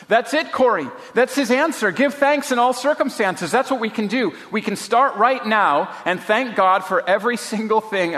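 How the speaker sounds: high, eager exclamation; excited. A narrator's reading. Betsy urges Corrie to give thanks, excitedly. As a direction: pitch it up with joyful conviction. Betsy acted, discovering the answer.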